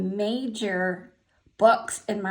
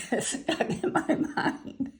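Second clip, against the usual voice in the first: laughing; 7 semitones higher, slower than usual. Real, not acted. Laughing remembering a book.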